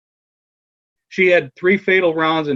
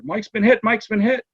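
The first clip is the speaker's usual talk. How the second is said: imitated urgent call, pitched sharply up; urgent alarm; 8 semitones higher than usual. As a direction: call out that a colleague is down. Relaying an officer's urgent alarm.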